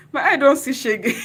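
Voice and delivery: overjoyed laughing burst; laughing loudly. Real, not acted. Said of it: "I'm already crying".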